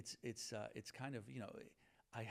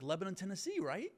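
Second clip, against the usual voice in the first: higher, louder, rising question; confident guess; 8 semitones higher, 8 dB louder than usual. Retold or imitated. Classmate guessing the town in Tennessee, confident. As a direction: imitate an eager classmate seeking confirmation.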